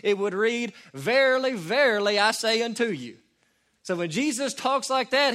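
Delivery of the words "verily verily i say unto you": lightly archaic, proclaiming verily; a touch of old-Bible solemnity, louder; mock King James proclamation; King James style. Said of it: "In the old King James Version".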